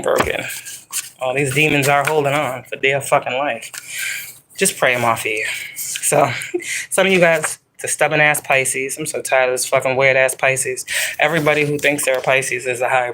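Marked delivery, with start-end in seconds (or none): none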